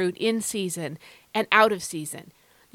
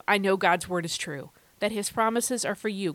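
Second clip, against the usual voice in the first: usual speaking voice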